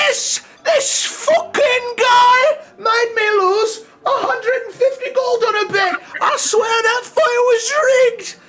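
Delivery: put-on very high, loud, slow crying shout of a drunk old man; a furious old drunk shouting across the tavern; angry old drunk. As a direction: rage like a sore loser who lost his bet. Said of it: "one very angry old man say".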